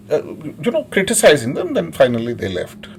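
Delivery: ordinary delivery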